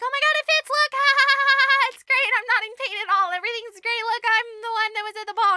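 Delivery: high, loud, fast babble with forced laughs; manic feigned delight. Retold or imitated. Stepsister insists shoe fits, manically cheerful. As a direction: overdo the joy to hide pain. Stepsister fakes joy despite agony.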